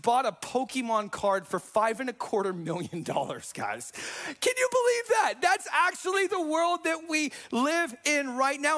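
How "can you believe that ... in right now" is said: stunned outcry; incredulous exclaim. Real, not acted. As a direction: shout it out, can you believe this. Preacher exclaims at the absurd world.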